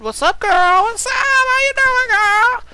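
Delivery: very high, loud sassy falsetto; high-pitched mock girly greeting. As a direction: squeal it like a gushing friend.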